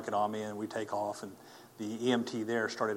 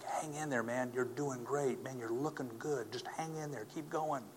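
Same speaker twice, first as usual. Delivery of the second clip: gentle encouragement; 3 semitones higher, 6 dB quieter than usual. Retold or imitated. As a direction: imitate people quietly cheering on a patient. Retells passers-by encouraging the patient.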